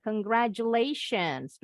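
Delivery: congratulations with J, con-gra-JU-lations; J sound. Shown as a demonstration. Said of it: "bunyi J". (congratulations dengan bunyi J)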